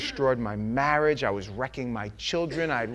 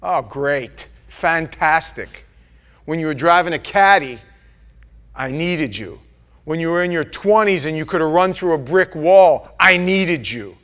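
loud, mocking dismissal; what God did not say, loud and scornful; sarcastic rejection; 10 dB louder than usual. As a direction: sneer at the washed-up man. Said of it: "he didn't say".

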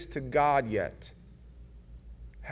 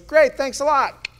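high, loud, dry sarcasm; sarcastic thanks; 10 semitones higher, 11 dB louder than usual. Acted. Young David thanking the prophet, high and sarcastic. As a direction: toss it off like a burdened boy.

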